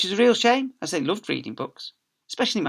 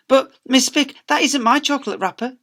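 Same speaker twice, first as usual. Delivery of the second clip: put-on much higher, louder child voice; protesting girl; 12 semitones higher, 9 dB louder than usual. Girl protesting to Miss Spick, high child voice. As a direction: plead innocence. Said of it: "the girl protested".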